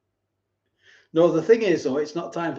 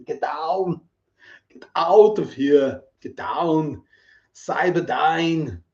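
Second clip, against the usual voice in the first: Arnold impression; 3 dB louder, slower than usual. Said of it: "I do a good impression".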